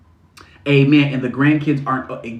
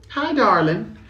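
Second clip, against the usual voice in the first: higher-pitched Southern greeting; sweet Southern accent, pitched up; 8 semitones higher, slower than usual. Acted. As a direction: greet them like a Southern belle. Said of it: "I do like a little Southern darling".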